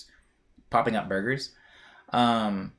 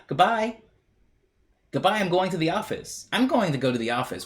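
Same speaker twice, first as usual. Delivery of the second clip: higher cheery sitcom dad farewell; imagined dad leaving; 6 semitones higher than usual. Retold or imitated. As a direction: play the chipper briefcase father. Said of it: "I was gonna go".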